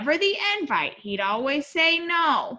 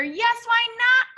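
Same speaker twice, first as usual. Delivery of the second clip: high, loud call; 9 semitones higher, 5 dB louder than usual. A narrator's reading. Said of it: "called the Dingoes".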